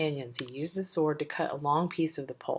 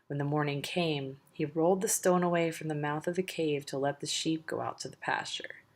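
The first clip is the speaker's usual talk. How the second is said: plain reading voice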